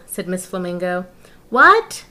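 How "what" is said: loud, high what; shouting over noise. Acted. Agent Lion shouting to Miss Flamingo over wailing babies. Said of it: "said Agent Lion".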